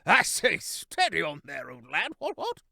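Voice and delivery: put-on high, jolly old chap voice; hearty hare voice. A narrator's reading. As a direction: bluff and breezy, brushing off the fight. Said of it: "A familiar voice rang".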